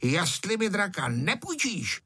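high-pitched angry threat, mildly shouted; angry shouting. A narrator's reading. Freckled boy threatening over the kite, angry cry. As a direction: threaten crossly, voice climbing high. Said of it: "začal zlobit a křičel".

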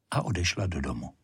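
ordinary delivery, nothing marked

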